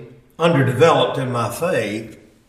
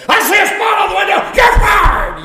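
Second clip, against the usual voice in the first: loud, much higher, fast furious yell; screaming boss; 6 dB louder than usual. Retold or imitated. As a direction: fire him on the spot over nothing. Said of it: "threatened to fire me".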